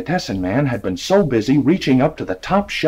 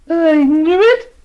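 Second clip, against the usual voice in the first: put-on high, slow gloating old lady; triumphant; 5 dB louder, slower than usual. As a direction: crow it in victory. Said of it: "said the old lady triumphantly".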